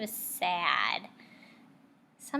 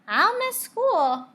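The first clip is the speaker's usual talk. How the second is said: put-on child voice; 12 semitones higher, 10 dB louder, slower than usual.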